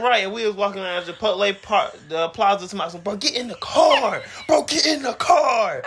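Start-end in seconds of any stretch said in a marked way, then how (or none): 3.0-5.8 mock pleading call. Begging her to get in.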